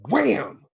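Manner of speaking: sudden high, loud slam noise; loud impact imitation. Acting out the box slamming on her finger, loud impact. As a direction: shout it like a box crashing down.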